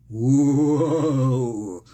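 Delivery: long, mock spooky "whoa"; spooky ghost wail. Joking about demon possession.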